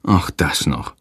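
low weary groan. A narrator's reading. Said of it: "stöhnt Hans".

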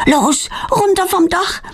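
high, pressing command; urgent high-pitched prompting; urging. A narrator's reading. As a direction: hurry a friend along urgently. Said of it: "drängt Claudia".

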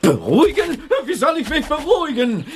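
high, indignant outburst, speeding up; very agitated. Acted. Driver told to calm down, agitated retort. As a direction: throw it back high and flustered. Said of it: "Der ist ganz aufgeregt".

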